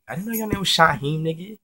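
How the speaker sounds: high, loud disbelief; retold incredulity. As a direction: act taken aback, pitch jumping up. Surprised at learning his name.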